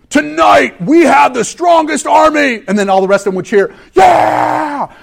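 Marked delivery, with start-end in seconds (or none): 0.1-2.6 booming war-cry shout, slow and drawn out; rallying shout. Retold or imitated. Preacher voicing a warlord rallying troops, big shout. 3.9-4.9 high shouted cheer; crowd cheer. Retold or imitated. Preacher voicing the cheering army, shouted yeah.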